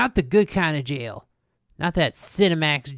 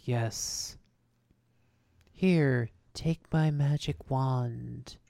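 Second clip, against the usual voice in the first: mystic guide voice; 4 semitones lower, 4 dB quieter, much slower than usual. Retold or imitated. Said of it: "And he's like".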